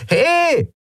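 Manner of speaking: very high yell for attention; shouting out. A narrator's reading. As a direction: yell it across the hangar. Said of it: "rief die klapprige Frau an der Tür wieder". (sehr hoher, scharfer Ruf)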